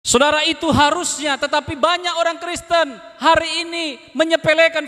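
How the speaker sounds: high, ringing preacher's cry; fiery preaching. Acted. Preacher rebukes careless Christians, preaching high and hard. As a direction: rouse the church with a rising sermon call.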